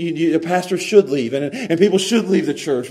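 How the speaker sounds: ordinary talk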